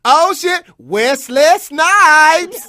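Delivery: high, loud, drawn-out shout of the name; starstruck shout. Retold or imitated. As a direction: stretch the name out in a yell. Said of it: "I would say".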